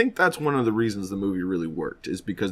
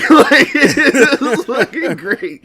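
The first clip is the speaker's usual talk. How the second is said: high, loud laughing talk; real laughter through the words; 14 semitones higher, 9 dB louder, slower than usual. Real, not acted. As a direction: laugh it out, still tickled. Laughing after the impression.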